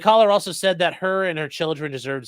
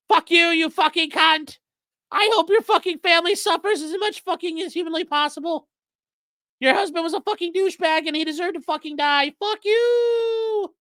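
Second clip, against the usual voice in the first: much higher mock falsetto with a laugh; castrato voice; 13 semitones higher than usual. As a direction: cackle the caller's words up high. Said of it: "doing the fucking castrati thing".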